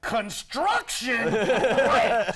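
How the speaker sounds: hammed-up sarcasm, slow and loud; sarcastic complaint. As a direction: sneer at the road works, dragging each word. Mocking complainers about road works.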